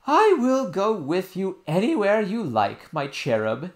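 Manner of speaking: high, loud, slow doting nurse voice; fond nurse. Acted. Nurse promising the princess, fondly. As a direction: give in lovingly.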